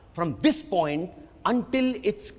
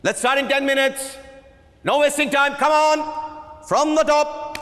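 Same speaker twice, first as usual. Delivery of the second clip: raised, higher commanding call to the cast; brisk director's call; 6 semitones higher than usual. Acted. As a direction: hurry the actors along from the back of the hall. Director starting rehearsal, calling actors.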